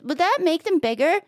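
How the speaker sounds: lightly voiced wife. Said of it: "the man voice still sound like her".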